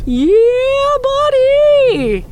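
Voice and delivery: very high, loud falsetto squeal; falsetto yeah buddy. Pretend. Catchphrase shouted in falsetto.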